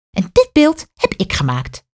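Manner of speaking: high, proud announcement; the museum lady proudly showing her statue. A narrator's reading. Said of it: "zegt de mevrouw trots".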